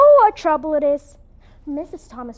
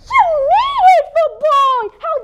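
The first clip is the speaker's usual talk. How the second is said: high, loud, furious cry; furious outburst; 13 semitones higher, 6 dB louder than usual. Acted. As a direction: explode at the boy in outrage. Anne insulted over her hair.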